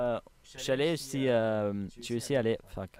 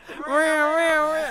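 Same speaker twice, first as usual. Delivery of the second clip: burst of laughter; 9 dB louder than usual. Real, not acted. Laughing at dad's story.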